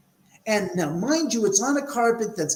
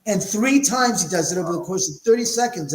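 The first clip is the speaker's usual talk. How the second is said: ordinary delivery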